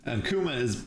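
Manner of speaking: ordinary delivery, nothing marked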